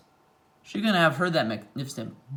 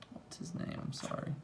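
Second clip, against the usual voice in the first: whisper; 18 dB quieter than usual. Real, not acted. Forgetting name, whispered aside.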